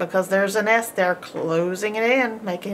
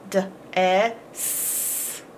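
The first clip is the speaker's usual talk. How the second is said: "D, E, S" stretched slowly, letter by letter; slow sounding out; 3 dB quieter, much slower than usual. Shown as a demonstration. Teacher sounding out letters for the class, slowly. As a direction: drag out each letter sound. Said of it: "teacher sounds out letters".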